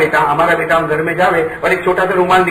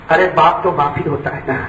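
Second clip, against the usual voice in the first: ordinary delivery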